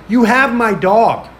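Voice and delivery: retold exasperation, drawn out; indignant accusation. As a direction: spell it out angrily, acting it out. Retold confrontation with taxidermist.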